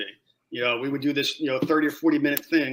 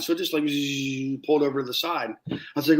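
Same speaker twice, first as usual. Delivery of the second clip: ordinary talk, nothing marked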